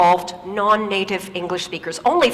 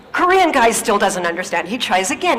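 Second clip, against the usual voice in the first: ordinary talk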